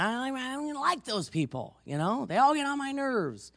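high-pitched mock complaint; griping. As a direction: whine it like a reluctant missionary. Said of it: "he was griping to the Lord".

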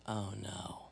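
low, hushed mock dread; imitated dismay. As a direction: drop it low and soft, like bad news dawning. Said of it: "the person that was with me goes".

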